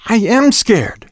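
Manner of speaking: high, drawn-out frightened cry; shouted. A narrator's reading. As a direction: cry it out slowly at a high pitch. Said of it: "she shouted".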